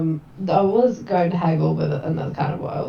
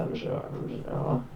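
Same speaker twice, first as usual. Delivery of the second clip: whispered aside; 8 dB quieter than usual. Real, not acted. Sarcastic aside about merchant's stock.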